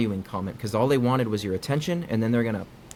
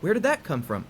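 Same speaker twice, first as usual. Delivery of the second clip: higher, hurt and taken aback; stunned defensive question; 8 semitones higher than usual. Shown as a demonstration. Sucker-punched partner demonstrating the stung reply, startled and defensive. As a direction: react as if just hit out of nowhere. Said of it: "the inflection in my voice".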